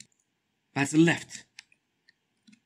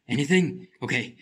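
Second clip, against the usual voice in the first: normal talking voice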